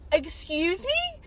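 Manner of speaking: drawn-out, rising, incredulous question; offended disbelief. Retold or imitated. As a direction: stretch it out slowly, pitch climbing high. Reacting to the hurtful insult.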